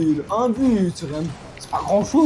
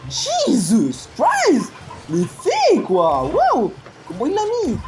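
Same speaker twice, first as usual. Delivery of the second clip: émerveillement; 8 semitones higher, 4 dB louder, much slower than usual. Real, not acted. But spectaculaire.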